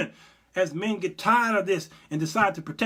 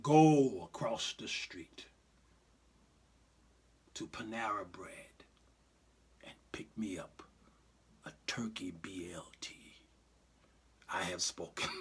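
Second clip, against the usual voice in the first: whispered Jesus voice; 9 semitones lower, 8 dB quieter than usual. Pretend. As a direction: whisper the order like a serene messiah. Jesus ordering a sandwich.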